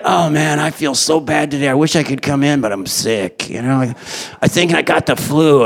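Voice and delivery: low, subdued mock-sick voice; feigned sick call. Employee calling in sick to the boss, feigning illness. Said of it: "they're like".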